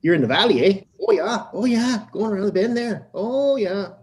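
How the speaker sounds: rural valley twang. Retold or imitated. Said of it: "I had a friggin' twang".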